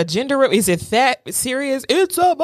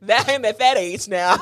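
usual speaking voice

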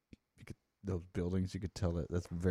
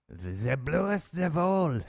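put-on French accent; 7 semitones higher, 5 dB louder, much slower than usual. Mocking French exclamation.

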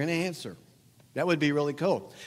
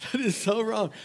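laughing disapproval; 6 semitones higher than usual. Real, not acted. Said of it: "I love this part".

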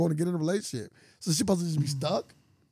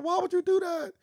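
high-pitched mock reproach; imitated upset woman; 14 semitones higher than usual. Retold mother questions her man, high imitated reproach. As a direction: scold in a put-on high voice. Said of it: "She's like".

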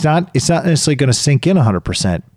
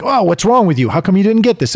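higher, impatient mock scolding; mock judgy; 7 semitones higher, faster than usual. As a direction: play the judgy coach, half-joking. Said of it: "then we get judgy".

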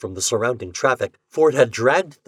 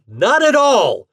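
high, loud, drawn-out retort; indignant shout; 14 semitones higher, 9 dB louder, slower than usual. A narrator's reading. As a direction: shout back, stretching the words. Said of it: "shouted the amateur detective indignantly".

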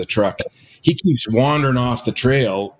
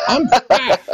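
laughing; 3 dB louder than usual. Real, not acted. Self-mocking laugh.

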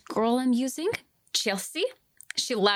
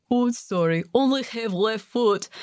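mock annoying husband; 4 dB louder than usual. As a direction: excuse yourself as a hammed-up useless husband. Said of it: "Must have driven his wife crazy".

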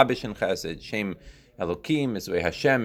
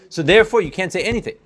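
emphatic loud conclusion; 8 semitones higher, 6 dB louder, much faster than usual. Real, not acted. Teacher drives conclusion home.

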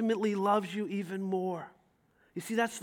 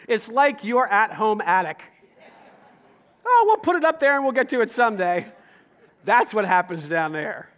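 louder, laughing jokey aside; laughing through it; 7 dB louder than usual. Real, not acted. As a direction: laugh at the cluttered attic while talking. Joking about the junk attic.

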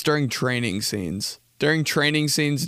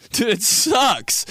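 higher, worked-up mock outburst; raised cracking voice; 9 semitones higher, much slower than usual. Comedian shows raising his voice when heated, high strained outburst. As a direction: let the voice jump up as if cracking. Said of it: "anytime I raise my voice".

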